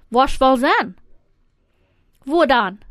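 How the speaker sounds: ordinary delivery, nothing marked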